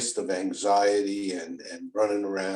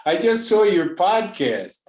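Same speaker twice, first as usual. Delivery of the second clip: excited friend; 9 semitones higher, 7 dB louder than usual. Retold or imitated. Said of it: "he says".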